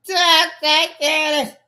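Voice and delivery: normal talking voice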